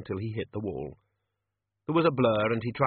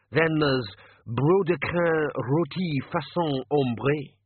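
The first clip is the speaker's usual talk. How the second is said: put-on slow, grandly drawn-out French accent; mock French dish name; 5 semitones higher, 4 dB louder, much slower than usual. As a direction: roll out the fake French proudly. Manager names another fake dish.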